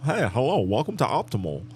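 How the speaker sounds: imitating a scripted operator, pitch swooping up and down; mock call-center voice. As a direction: recite it like an overly proper phone agent. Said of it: "If you start talking like".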